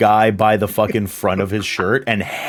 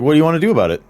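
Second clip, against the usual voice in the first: taunting challenge; 9 semitones higher, 5 dB louder, much faster than usual. Acted. Modi taunting after slapping Uptown.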